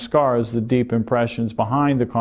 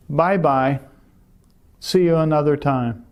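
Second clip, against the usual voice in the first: ordinary talk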